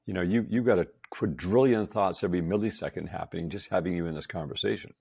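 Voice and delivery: ordinary delivery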